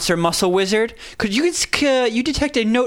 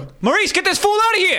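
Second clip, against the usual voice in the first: shouting; 10 semitones higher, faster than usual. Pretend. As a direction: yell across the room for the bouncer. Character calls for bouncer.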